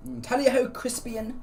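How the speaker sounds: high-pitched mock British toff; cartoonishly high British gent. Shown as a demonstration. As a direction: play up a hammy British accent. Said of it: "the most stereotypical thing you could have said".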